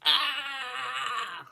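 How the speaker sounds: high, drawn-out frustrated "aah"; mock scream of stress. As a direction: let out an exasperated, upset cry. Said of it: "like, stress, upset".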